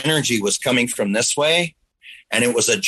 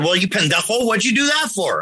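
much higher, exasperated mother; higher mock scolding mother; scolding mom; 9 semitones higher than usual. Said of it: "And she said".

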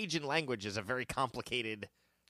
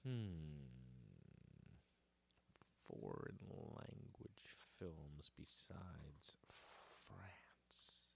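slow quiet whisper while thinking; whispering to self; 9 semitones lower, 23 dB quieter, much slower than usual. Real, not acted. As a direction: mutter it under your breath.

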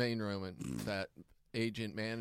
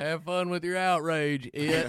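loud, high, slow southern taunt; mock southern accent; 5 semitones higher, 7 dB louder, slower than usual. Comic taunting the outraged crowd, loud hammed-up southern accent. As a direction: drawl it loudly, relishing their outrage. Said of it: "give them a southern accent".

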